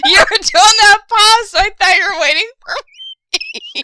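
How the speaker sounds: loud, high, hysterical self-defence; cracking up while explaining; laughing protest. Real, not acted. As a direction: protest at a squeal through laughter.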